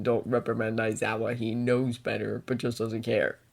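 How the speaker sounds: soft, low read of the message; quiet. Acted. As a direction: say it under your breath. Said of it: "Don't call out Aizawa, you mean?".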